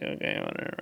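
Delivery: breathy, unbothered whisper; whispered deadpan. Retold or imitated. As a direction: shrug it off in a whisper. Deadpool shrugging off being shot.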